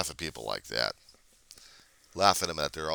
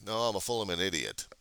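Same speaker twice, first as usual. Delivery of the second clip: normal talking voice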